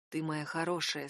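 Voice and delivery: тихо и ласково, гораздо ниже; скажи мягко, как ребёнку; нежно. Acted. Нежно утешает героиню. (нежным тихим голосом)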